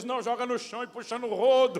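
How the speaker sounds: gruff reply. Retold or imitated. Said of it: "Ele diz".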